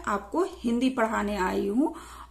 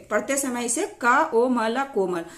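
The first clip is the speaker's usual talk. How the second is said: normal talking voice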